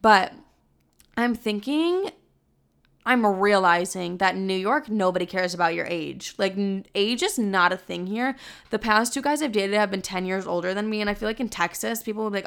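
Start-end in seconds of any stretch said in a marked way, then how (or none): none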